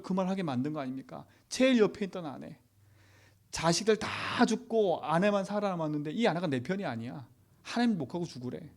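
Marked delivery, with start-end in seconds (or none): none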